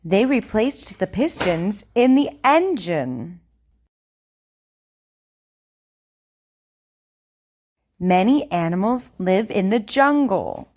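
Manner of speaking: over enunciating. Shown as a demonstration. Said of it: "I want you to repeat after me".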